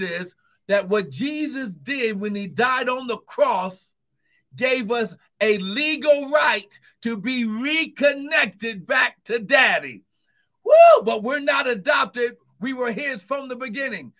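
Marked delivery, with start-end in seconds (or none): 4.5-10.0 full force preaching. Acted. 10.6-11.0 piercing high whoop; ecstatic whoop. Real, not acted. Pastor whooping mid-sermon at the congregation, piercing ecstatic whoop. 11.0-14.1 full force preaching. Acted.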